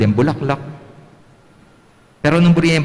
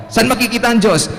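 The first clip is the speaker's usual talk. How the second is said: teacher's question; 10 semitones higher than usual. Pretend. Teacher asks Reynold.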